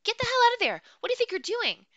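high piercing shriek, very loud. Acted. Woman catching the boy in her pack, shrieking. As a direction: shriek it like a banshee. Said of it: "she shrieked like a banshee".

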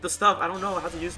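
ordinary talk, nothing marked

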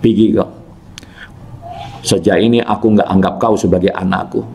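ordinary delivery

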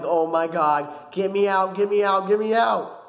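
acted panic, pitch climbing; frantic retold begging to escape; freaking out. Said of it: "So I'm freaking out at this point".

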